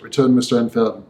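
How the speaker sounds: ordinary delivery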